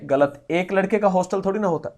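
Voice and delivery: ordinary delivery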